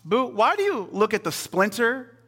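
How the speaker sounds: high, casual mock challenge; Jesus. Preacher voicing Jesus questioning the congregation, playful high challenge. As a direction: ask it high and loud, stretching the words. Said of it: "Jesus goes".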